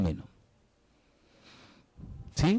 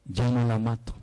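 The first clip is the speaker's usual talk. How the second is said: ordinary talk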